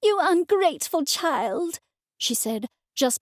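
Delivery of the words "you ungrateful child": high, wounded, accusing; hurt anger. A narrator's reading. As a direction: fling it as you leave. Mother storming out.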